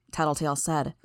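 plain reading voice

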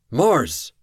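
loud, high call of her name; shout. A narrator's reading. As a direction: yell to stop her. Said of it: "Kraus shouted".

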